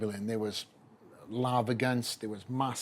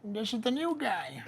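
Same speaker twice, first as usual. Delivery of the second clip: put-on much higher, slow voice of an old man; 12 semitones higher, slower than usual. Joe Weider welcoming the new athlete, high old-man voice. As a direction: do an elderly boss calling you over. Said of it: "he was like".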